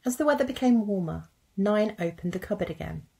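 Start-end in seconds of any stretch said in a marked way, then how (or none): none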